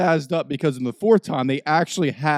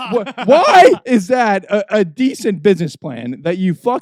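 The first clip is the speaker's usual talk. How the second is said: furious disbelief; outraged; 5 semitones higher, 5 dB louder than usual. Real, not acted. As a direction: vent the outrage. Ranting about chips policy.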